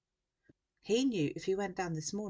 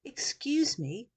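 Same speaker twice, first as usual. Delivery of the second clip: loud, high, drawn-out demand; much louder; 8 semitones higher, 6 dB louder, much slower than usual. A narrator's reading. Sam to the journalist ignoring him, much louder. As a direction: insist on being heard, slowly and loudly. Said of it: "much louder this time".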